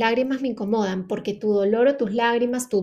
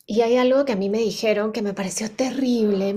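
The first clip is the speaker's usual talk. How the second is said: ordinary delivery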